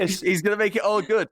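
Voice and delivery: teasing high echo of an excited fan; mimicked gushing. Co-host echoing the fan's hope, high mock excitement. Echoes co-host's gushing quote.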